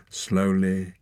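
usual speaking voice

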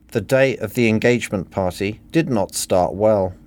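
ordinary delivery, nothing marked

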